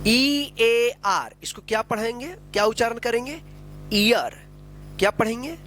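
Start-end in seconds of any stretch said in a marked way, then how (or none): 0.1-1.3 each letter held long; drawn-out, separate letters; slow spelling. Shown as a demonstration. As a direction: spell it very slowly for learners. Teacher spells out word for learners.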